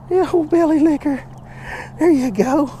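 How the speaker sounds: put-on high baby-talk to a dog; doggy talk. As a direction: coax the dog along, sing-song. Coaxing the dog.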